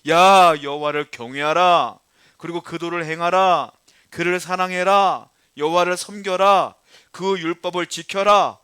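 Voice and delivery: shouted list of nagging commands; loud nagging. Acted. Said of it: "잔소리처럼 뭐라고 말해요".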